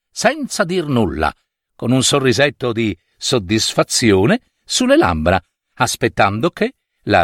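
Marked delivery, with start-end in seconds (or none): none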